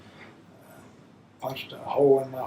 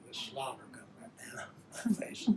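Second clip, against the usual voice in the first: laughing softly; 18 dB quieter than usual. Real, not acted. Fond memory of drooling baby.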